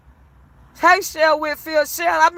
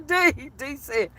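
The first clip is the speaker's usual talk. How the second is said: ordinary delivery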